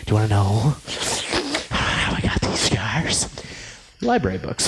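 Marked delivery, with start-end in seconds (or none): 0.0-3.3 slow lower breathy rasp; Joker-style rasp. Pretend. Cohost doing a slow rasping villain line about scars. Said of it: "the voice of Batman".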